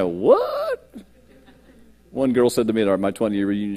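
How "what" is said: squeaky, high, disbelieving "What?"; shocked "What?". Retold or imitated. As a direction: mimic old classmates' stunned disbelief. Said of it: "look at me and say".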